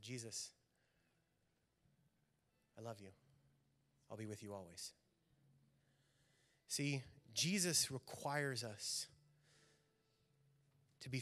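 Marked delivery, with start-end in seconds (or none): 0.0-4.9 much softer, lower, hushed devotion; quiet last words of a faithful life; tender final words. Retold or imitated. Dying woman's last words.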